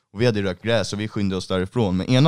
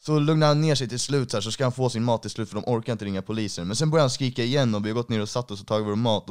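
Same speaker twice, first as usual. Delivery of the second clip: usual speaking voice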